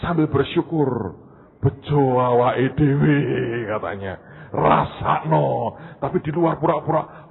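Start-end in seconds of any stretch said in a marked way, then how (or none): 1.6-3.2 slow, loud taunting in Javanese; mocking ball voice. Ball gloating at its unlucky friend, slow and loud. 4.5-5.8 slow, louder serves-you-right; gloating taunt. Acted. Ball gloating over its friend's fate, drawn out.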